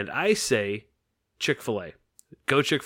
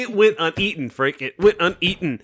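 indignant outburst; 6 semitones higher, 6 dB louder than usual. Acted. Mock complaint to Frank.

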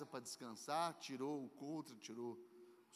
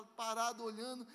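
ordinary talk

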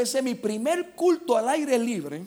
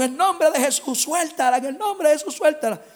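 loud, raised-pitch rebuke; exorcism command; 7 semitones higher, 7 dB louder than usual. Retold or imitated. Preacher commands demon to release the woman, shouted rebuke. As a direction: order the demon out forcefully.

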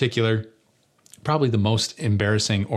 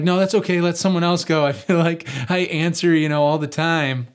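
nervous excuse; 7 semitones higher than usual. Retold or imitated. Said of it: "I get nervous".

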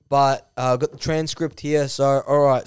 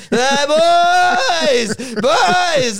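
high, loud, drawn-out holler; hammed-up greeting yelled to the lads; calling out; 6 dB louder, much slower than usual. Naked intruder calls out in story.

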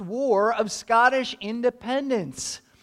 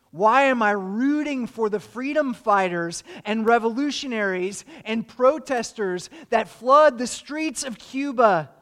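usual speaking voice

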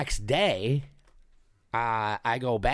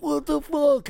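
high, louder alarmed outburst; panicked brother; 5 dB louder, slower than usual. Retold or imitated. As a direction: blurt out in panic. Brother panicking over his lip.

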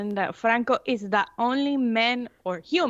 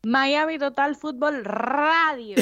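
put-on slow Spanish-accented show name; 5 semitones higher than usual. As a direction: announce it with Latin flair. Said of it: "That was fantastic".

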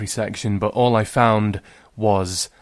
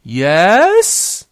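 loud high reply; called reply; 9 semitones higher, 7 dB louder than usual. Acted. Girl answers dad from upstairs, loud high. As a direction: call back innocently.

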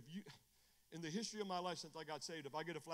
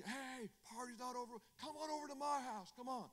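party reveler calling; 7 semitones higher than usual. Acted. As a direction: imitate a drunk rallying the club. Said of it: "and say".

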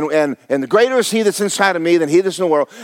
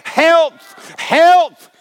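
building preaching shout; 7 semitones higher, much slower than usual. Acted. Preacher building up the congregation, rising to a ringing shout. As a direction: climb to a ringing shout on health.

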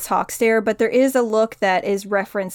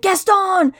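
high, loud dramatic shout; calling out a name; 10 semitones higher, 5 dB louder than usual. Acted. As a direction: announce the name with mock drama. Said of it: "it's just like".

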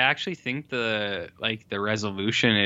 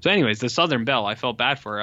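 ordinary talk

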